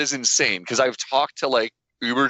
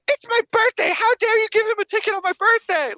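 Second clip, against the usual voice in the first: high shrill-free mock mom yell, much higher; mom yelling at cop. Mom protesting to the cop about her birthday ticket, high mock yell. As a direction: squeal it like an outraged mom. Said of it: "she's yelling at the cop".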